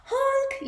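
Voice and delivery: high loud honk; dump truck honk. Acted. The dump truck yelling at the animals, a loud high honk. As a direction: blast a big horn. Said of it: "yelled a dump truck".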